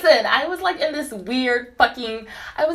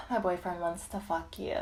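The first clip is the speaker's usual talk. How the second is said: hushed proposition; 9 dB quieter than usual. Retold or imitated. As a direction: retell her offer softly, like a confidence. Retells the woman's hushed proposition.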